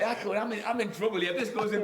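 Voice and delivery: imitating a footballer, high, loud and laughing; mock panicked defender. Mate voicing the defender left on the halfway line, high and laughing. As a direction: play the stranded defender thinking aloud, half laughing. Eric's inner voice continues.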